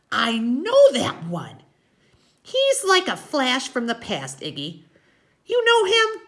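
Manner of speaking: high, loud woman's character voice; Loretta, insistent. A narrator's reading. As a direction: press Iggy in a high, excited voice.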